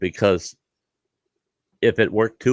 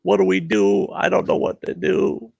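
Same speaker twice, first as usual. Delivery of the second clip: higher, frantic imitation of a friend; panicked buddy; 8 semitones higher than usual. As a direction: play the helpless friend in a panic. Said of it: "my buddy's going".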